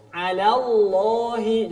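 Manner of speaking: alallahi drawn out slowly. Shown as a demonstration. Teacher models alallahi for students. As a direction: stretch alallahi.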